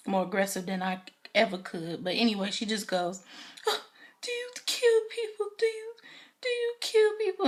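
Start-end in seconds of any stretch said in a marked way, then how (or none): 3.7-7.5 much higher, whiny fake-crying voice; hammed-up tearful pleading in a squeaky voice; mock distraught daughter. Olivia begging her father for the truth, mock sobbing and squeaky.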